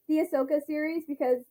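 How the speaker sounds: ordinary delivery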